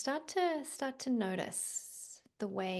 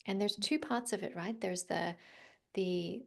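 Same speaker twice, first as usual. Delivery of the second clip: usual speaking voice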